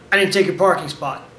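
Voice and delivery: firm, flat statement at normal pitch; flat, emotionless. Shown as a demonstration. Answering a guy yelling about parking, flat and emotionless. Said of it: "stay flat and emotionless".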